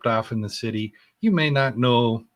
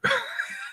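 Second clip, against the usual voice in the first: breathy giggle; 6 dB quieter than usual. Real, not acted. Giggles mid Star Trek story.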